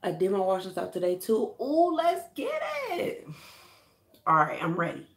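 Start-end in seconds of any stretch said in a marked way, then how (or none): 1.6-3.3 high excited yell to kick things off; hype shout. Real, not acted. Vlogger hyping his viewers up, excited high shout. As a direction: fire yourself up before washing.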